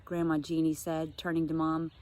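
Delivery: ordinary delivery, nothing marked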